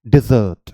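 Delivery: डिजर्ट with a "di" start and stress on "zert"; dessert pronounced. Shown as a demonstration. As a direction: say डिजर्ट clearly, stressing the end. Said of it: "इसकी pronunciation क्या हो जाएगी". (डिजर्ट, दूसरे हिस्से पर ज़ोर)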